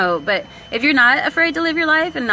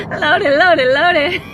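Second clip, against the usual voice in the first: laughing, louder, Loaded, loaded, loaded; 5 semitones higher, 6 dB louder, much slower than usual. Real, not acted. Cracking up on stream at her own word, laughing. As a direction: crack up while saying loaded. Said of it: "that's how it sounds".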